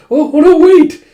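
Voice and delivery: very high, loud acted yelp; hammed-up panicked protest; alarmed shout. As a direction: shout frantically to stop someone. Girl stops him eating raw meat.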